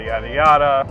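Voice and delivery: usual speaking voice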